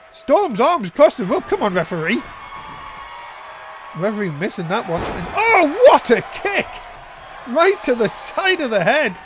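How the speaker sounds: excited ringside call; wrestling commentator. Real, not acted.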